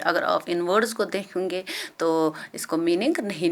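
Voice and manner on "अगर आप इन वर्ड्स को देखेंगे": usual speaking voice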